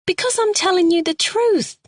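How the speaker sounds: a frightened suspect begging to be believed; Carol, distressed woman. A narrator's reading. Suspect pleads her story to the sergeant, high and pleading.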